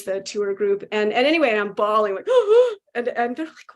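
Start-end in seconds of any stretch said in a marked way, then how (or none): 2.3-2.9 loud, high gasp for breath; a gasp caught mid-bawl; sobbing gasp. Retold or imitated. Bawling at the cafe, a loud sobbing gasp.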